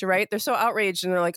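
usual speaking voice

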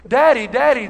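acted tearful plea; mock sobbing child. A narrator's reading. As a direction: imitate an upset boy calling his father. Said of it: "the boy sobbed".

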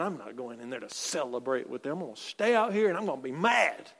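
low, soft pouting refusal; sulky older brother. Retold or imitated. Older son refusing to join the party, low mock pout. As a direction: play the sulking son who won't join in. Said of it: "He was angry and wouldn't go in".

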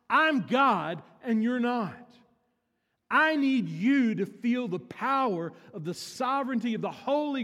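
loud, high-pitched preacher voice; full-force preaching. Acted. God commanding Moses before he faces Pharaoh, in full-force preaching. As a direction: thunder it out as God.

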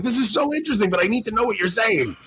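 high-pitched, comically frustrated pleading; mock desperate plea. Retelling his plea to the Scottish priest, exasperated. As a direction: plead to be let in on it.

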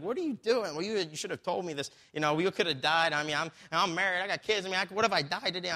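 high-pitched, stressed-out retold protest; mock flipping out. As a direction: imitate a rattled student pilot complaining. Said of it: "He's flipping out".

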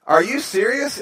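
stunned he nearly jumped; shocked disbelief. Retold or imitated. Speaker shocked by warning.

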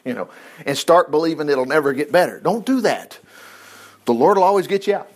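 normal talking voice

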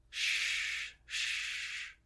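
soft, drawn-out unvoiced shush; gentle hushing. A narrator's reading. Cleric hushes a delirious patient, soft shushing. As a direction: hush them softly with breath alone.

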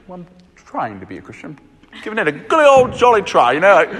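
hint of a British accent, half-hearted; polite British answer. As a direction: play the politely unsure Englishman. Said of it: "kind of a polite British answer".

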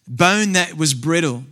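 usual speaking voice

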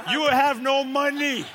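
slow, high, loud comic heckle; exasperated shout. Acted. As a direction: call it out slowly at the person ahead. Person behind shouting at cash machine user.

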